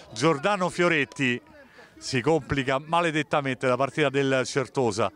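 usual speaking voice

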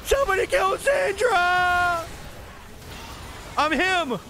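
high-pitched, desperate battle yell; urgent shouts climbing very high; frantic shouting. Real, not acted. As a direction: scream orders to your team mid-fight. Urgent calls in team fight.

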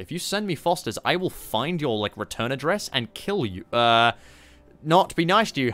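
usual speaking voice

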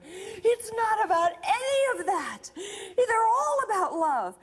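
high, tearful, stretched-out insistence; imitated impassioned cry, drawing words out; intense revelation. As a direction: wail the correction with passion. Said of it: "started this kind of intense".

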